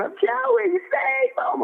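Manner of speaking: high, frantic mock yell; retold yell. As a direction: yell it up high, begging him to save the fighter. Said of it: "He's yelling".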